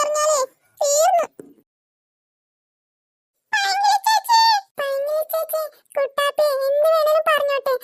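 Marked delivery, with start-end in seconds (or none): 3.5-4.4 calling out. Acted. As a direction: call out after her. Calling after the upset girl.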